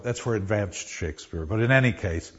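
ordinary delivery

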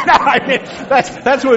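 high laughing burst. Real, not acted. Teacher laughing at his contractor joke, high and loud. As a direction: crack up at your own joke. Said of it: "laughing again".

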